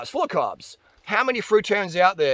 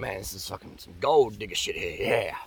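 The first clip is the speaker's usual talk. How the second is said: much lower, sly inner voice of the trainer; scheming trainer's inner voice; 10 semitones lower, 6 dB quieter than usual. Retold or imitated. Trainer thinking about the rich client, lower and sly. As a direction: mutter it like a smug con man. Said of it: "this guy's just thinking".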